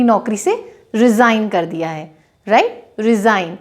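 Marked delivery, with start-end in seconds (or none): none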